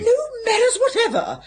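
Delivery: high, indignant rebuke; indignant scolding. Acted. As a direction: scold him for bad manners. Scolding brother for rudeness.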